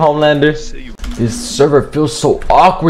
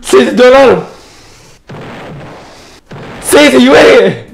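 angry yell; 5 semitones higher than usual. Real, not acted. Yelling at teammate in game.

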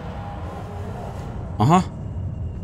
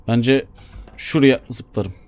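normal talking voice

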